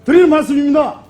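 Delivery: lecturing at full volume; shouting match. Real, not acted. Heated parliamentary argument, near shouting.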